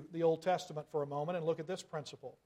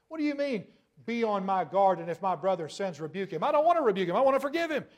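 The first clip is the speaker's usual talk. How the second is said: hammed-up indignant protest; mock whiny objector; 2 semitones higher, 4 dB louder, faster than usual. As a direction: parody a complaining churchgoer. Mocking a protesting listener.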